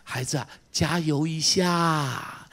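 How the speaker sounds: put-on father soothing a child in a whisper; God's tender whisper. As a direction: hush it gently to a hurting child. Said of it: "上帝在那边笑笑说".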